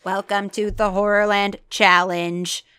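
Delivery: a mock-menacing loudspeaker boom. A narrator's reading. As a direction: boom it out like a scary PA. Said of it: "boomed a deep, frightening voice".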